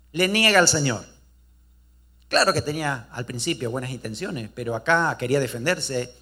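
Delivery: normal talking voice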